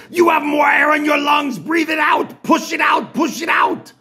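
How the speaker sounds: yelling like a drill sergeant. Retold or imitated. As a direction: push him hard like a relentless coach. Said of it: "like I was being yelled at by the Terminator".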